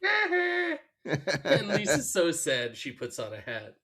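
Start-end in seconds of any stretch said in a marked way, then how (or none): 0.0-0.8 high mocking Nelson haw-haw; Nelson laugh. Bully Nelson mocking Lisa with a high taunt. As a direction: sneer it like Nelson.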